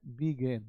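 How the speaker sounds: "Begin" said as bi-GEN, falling; "Begin" turned into past-tense began, bi-gen; pronounced "began". Shown as a demonstration. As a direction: say "Begin" with an e in the second syllable, low and soft. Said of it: "क्या है?".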